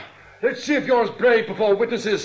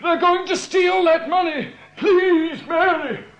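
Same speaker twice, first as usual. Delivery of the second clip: desperate plea; 5 semitones higher, slower than usual. Acted. Tied man pleads to be believed.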